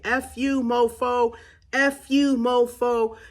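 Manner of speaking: high, loud angry scream; furious yell, letting the anger out; screaming at them. Shown as a demonstration. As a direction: scream it at the person who hurt you. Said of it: "you just start yelling, you start screaming".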